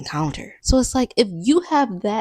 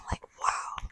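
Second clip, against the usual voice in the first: awed whisper; 13 dB quieter than usual. Real, not acted. Awed at the glass island house.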